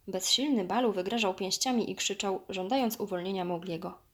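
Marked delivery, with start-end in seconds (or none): none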